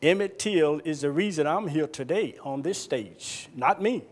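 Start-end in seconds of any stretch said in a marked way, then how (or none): none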